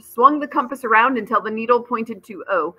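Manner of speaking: usual speaking voice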